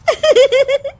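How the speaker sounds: sharp high mocking laugh. Daughter-in-law laughs mockingly at her mother-in-law, high laugh. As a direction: laugh at the mother-in-law's sweetness. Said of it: "हंसकर कहती है".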